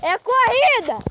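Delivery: loud excited yell; shouting. Real, not acted. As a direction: call out that it's a race. Shouting that it's a race again.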